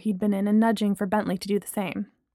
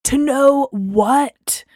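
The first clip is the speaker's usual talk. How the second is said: slow, loud insistent question; persistent; 4 semitones higher, 6 dB louder, much slower than usual. A narrator's reading. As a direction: drag out the demand. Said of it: "Braylon persisted".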